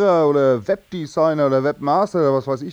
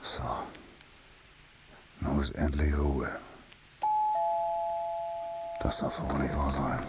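very low, soft grumble; weary muttering; 13 semitones lower, 14 dB quieter than usual. Acted. Man alone after the phone battle, weary muttering. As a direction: sink down to a private mutter.

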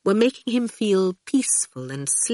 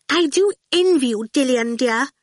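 high, louder gushing Miss Potter figure; brightly, masking annoyance; 9 semitones higher, 4 dB louder than usual. A narrator's reading. Miss Potter to Dillion, obviously annoyed at being left out, falsely bright. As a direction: gush brightly over hidden annoyance. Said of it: "Miss Potter said brightly, obviously annoyed".

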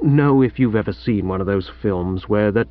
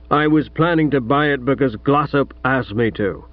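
lightly voiced gruff American father; a hint of an overbearing American millionaire; American tycoon father.

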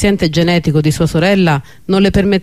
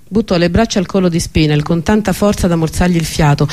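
ordinary delivery

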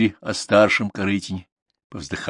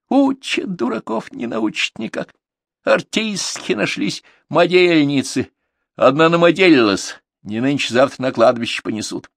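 mocking scold; 8 semitones higher than usual. Mother scolding daughter.

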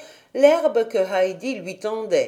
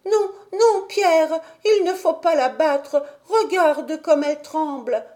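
put-on high-pitched, urgent child's outcry; pleading cry; 7 semitones higher, 3 dB louder than usual. A narrator's reading. Heidi begs Pierre not to beat the goat, crying out. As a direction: cry out to stop him. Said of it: "Heidi s'écria".

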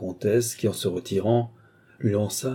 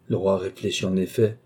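usual speaking voice

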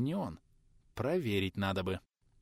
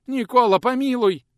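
pleading; 13 semitones higher, 10 dB louder than usual. A narrator's reading. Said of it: "Попытался отнекиваться тот".

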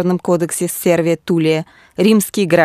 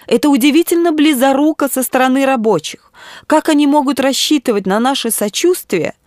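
bishop's raised-pitch indignation; agitated exclamation; 7 semitones higher than usual. A narrator's reading. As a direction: protest heatedly in a higher voice. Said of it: "воскликнул он". (взволнованно и возмущённо)

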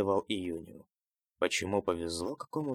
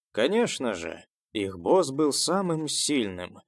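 usual speaking voice

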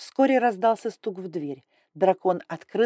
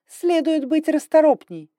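higher, louder cold rebuke; scolding; 10 semitones higher, 8 dB louder than usual. Acted. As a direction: scold the slow maid. Grai scolds servant.

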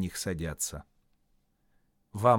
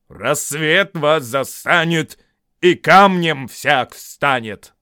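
slow, loud, drawn-out pronouncement; Вильям; 4 semitones higher, 11 dB louder, slower than usual. A narrator's reading. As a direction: intone it slowly like a spell. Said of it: "похожий на голос Вильяма".

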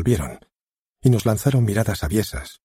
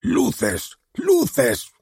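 shouting for lights. Acted. The director calling for the lights, a rising yell. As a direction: call again, harder each time. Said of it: "vociferó una voz gangosa".

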